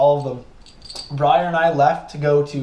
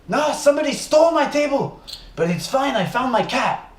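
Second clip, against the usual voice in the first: imitating a French accent, much higher; thick French accent; 10 semitones higher than usual. Odd French neighbor explaining his table, thick French accent. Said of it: "he speaks with a very thick French accent".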